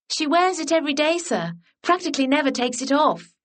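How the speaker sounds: put-on high, quick tattling girl; cheeky schoolgirl. A narrator's reading. Melisande telling the gentleman about the shawl, high and quick. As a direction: blurt it out fast and pleased with yourself.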